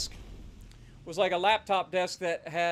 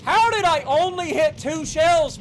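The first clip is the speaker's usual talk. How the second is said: higher, louder, slow, exasperated shout; furious; 9 semitones higher, 11 dB louder, slower than usual. Real, not acted. Streamer raging at the game over missed shells, furious yell. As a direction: yell it out in disbelief.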